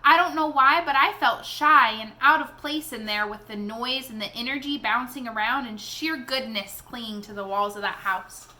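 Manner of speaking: ordinary delivery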